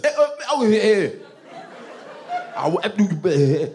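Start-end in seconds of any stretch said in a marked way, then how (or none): 0.0-1.1 high, loud grunting syllables mid-whooping; wordless father grunts. Retold or imitated. Father grunting wordless sounds while whooping his kids. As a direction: grunt like a dad swinging a belt. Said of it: "my father wouldn't even use full sentences when he whooped us".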